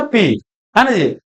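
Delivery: ordinary talk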